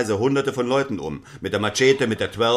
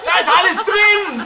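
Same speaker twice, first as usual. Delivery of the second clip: much higher, loud, frantic wailing; despairing outcry; 5 dB louder than usual. Acted. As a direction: wail at the ruined phone. Mann verzweifelt über kaputtes Handy. (schrill verzweifelte Klage)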